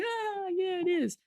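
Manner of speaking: mock reply. Mocking others' dismissive reply.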